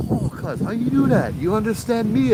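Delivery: very high, imitated astonishment; amazed. Patient amazed that someone understands him, astonished.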